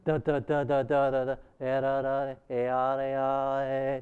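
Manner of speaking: droning repeated syllables on one note; hypnotic monotone chant. Shown as a demonstration. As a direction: imitate a trance-like chant, all on one level pitch. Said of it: "that's hypnotic".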